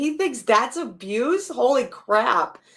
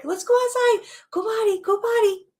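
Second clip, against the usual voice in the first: put-on much higher sing-song coaxing, like talking to a baby; high puppy talk; 11 semitones higher than usual. Owner scooping up the puppy to go outside, high coaxing puppy talk. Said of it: "then you pick 'em up real quick".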